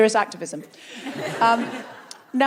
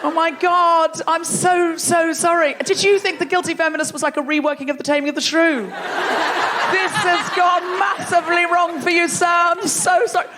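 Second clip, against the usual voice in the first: higher, exaggerated dismay; mock-horrified apology; 4 semitones higher than usual. Comic to misled Shakespeare tourist, hammed-up apology. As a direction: gush apologies as if disaster struck.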